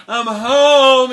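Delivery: loud, high arrival call. Acted. Jack announcing himself to his mother, calling out. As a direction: holler it through the house.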